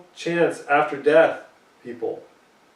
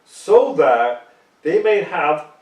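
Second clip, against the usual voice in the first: slow, loud and raised start of the verse; emphatic reread; 3 semitones higher, 8 dB louder, slower than usual. Acted. Preacher rereads the promise to his listeners, slow and loud. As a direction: lift the words so that they may have.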